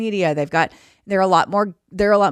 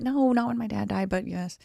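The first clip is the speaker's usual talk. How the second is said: tag as hushed reply; 3 semitones higher, 8 dB quieter than usual. Retold or imitated. Acted answer about a sad memory.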